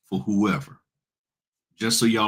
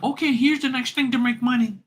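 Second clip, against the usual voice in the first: much higher, mocking huckster voice; mock hype pitch; 14 semitones higher than usual. Parodying hustlers who pitch the next money scheme, in a high mocking voice. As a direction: sell it like a cheesy guru.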